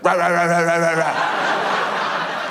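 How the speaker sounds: a hint of a man barking, dragged out; mock dog bark, half laughing. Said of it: "barking like a dog".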